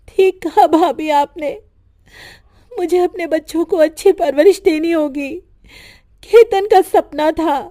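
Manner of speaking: put-on a widow steeling herself for her girls; grieving young widow. Kirti answers sister-in-law.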